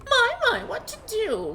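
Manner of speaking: high sing-song echo of the character; mocking character voice. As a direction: parrot the line in a high mocking lilt. Imitates character line.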